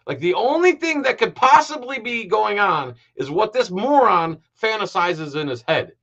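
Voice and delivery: high-pitched, incredulous outburst; exasperated rant. Real, not acted. Commentator tears into the cop's assumptions, exasperated. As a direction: let exasperation push the pitch up.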